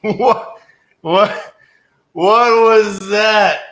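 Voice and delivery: high, loud disbelief; real stunned outburst; astonished. Real, not acted. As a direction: burst out baffled, stretching the last question. Reacting in disbelief after bizarre call.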